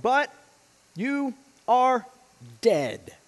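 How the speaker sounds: slow, weighty scripture read; solemn Bible reading. Acted. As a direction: read the letter with gravity. Reads scripture letter aloud.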